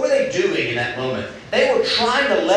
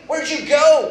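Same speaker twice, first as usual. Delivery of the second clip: higher, aching question falling off; longing plea; 8 semitones higher, 3 dB louder, slower than usual. Retold or imitated. God calling out to hiding Adam, higher and longing. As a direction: ask it with a broken heart. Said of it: "It's more the longing of his heart".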